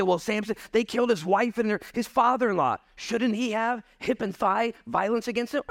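mock outrage on behalf of Samson; indignant outcry. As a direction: demand revenge, worked up. Congregation voiced demanding vengeance.

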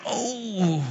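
soft mock-pained "oh"; deflated groan. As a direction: groan as if caught out, hammed up quietly. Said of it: "And you're like".

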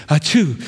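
loud mock sneeze; fake sneeze. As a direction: sneeze it out theatrically. Fake sneeze in acted quote.

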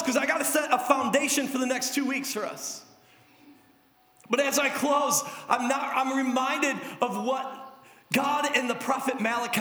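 ordinary talk, nothing marked

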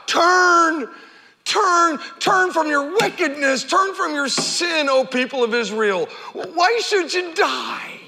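pleading heart of God. Acted. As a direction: plead tenderly, drawing it out.

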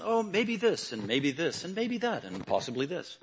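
a hint of a timid preacher hedging everything; mock wishy-washy preaching. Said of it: "It wasn't".

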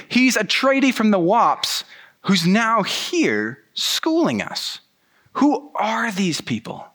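high, slow, breathy disbelief that starts as a whisper; incredulous mumble. Retold or imitated. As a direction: hiss it in shocked disbelief. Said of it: "mumbling to each other".

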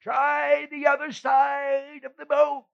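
high, slow, shouted from afar; calling out. Acted. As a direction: call it across the water, slow. Voice calling from the shore.

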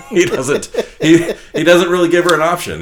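high, loud laughing words. Real, not acted. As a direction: laugh through the line. Laughing while telling.

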